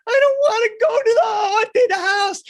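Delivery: very high, loud crying whine; scared little kid. Retold or imitated. Little Marky refusing haunted house, high crying whine. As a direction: wail it like a panicked small boy.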